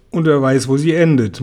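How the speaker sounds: usual speaking voice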